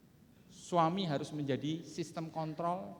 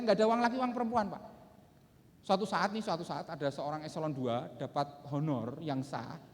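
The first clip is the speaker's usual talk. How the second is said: usual speaking voice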